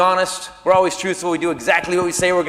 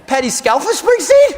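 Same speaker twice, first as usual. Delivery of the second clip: high, loud, astonished question; starstruck disbelief; 10 semitones higher, 5 dB louder than usual. Acted. Fan on the phone to a rock star's wife, starstruck disbelief. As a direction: squeal the name like a stunned fan.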